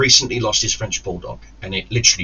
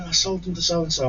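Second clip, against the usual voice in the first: high, drawn whiny confession; mocking house sitter; 8 semitones higher, 4 dB quieter than usual. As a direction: mimic her in a high, soft voice.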